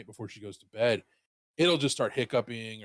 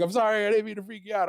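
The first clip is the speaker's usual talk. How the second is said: put-on quick apologetic girlfriend; 7 semitones higher, faster than usual.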